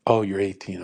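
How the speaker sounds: usual speaking voice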